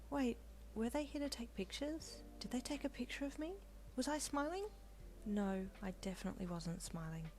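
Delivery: panicking quietly to herself; heroine's anxious inner voice; anxious inner thoughts. Acted.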